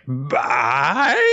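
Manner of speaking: drawn-out word sliding up high; baffled question. Retold or imitated. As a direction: stretch it into a puzzled, rising cry. Acted baffled character reply.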